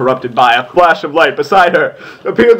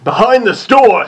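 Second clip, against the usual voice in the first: manly voice; 6 semitones higher than usual. Acted. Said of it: "such a manly voice".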